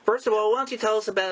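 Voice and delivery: normal talking voice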